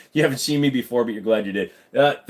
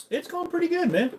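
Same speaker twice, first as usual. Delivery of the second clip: chatter's voice; 4 dB quieter than usual. Pretend. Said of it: "answering as the chatter".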